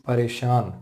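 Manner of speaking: normal talking voice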